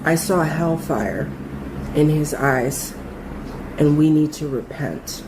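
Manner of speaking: low, slow, haunted voice; eerie quoted warning. Retold or imitated. Coworker tells her friend, in a low slow haunted voice. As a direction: deliver it like a spooked prophecy. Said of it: "and I quote".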